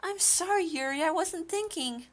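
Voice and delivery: high, soft apologetic character voice; Yuri's apologetic classmate. Acted. Reading a visual novel aloud, apologetic.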